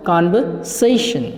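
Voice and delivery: कन्वर्सेशन as kanvaseshan, no R, slowly; correct pronunciation, slow. Shown as a demonstration. Teacher drilling the right form slowly for the class. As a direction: draw out कन्वर्सेशन with the R silent. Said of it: "क्या बोलना है आपको इसको?".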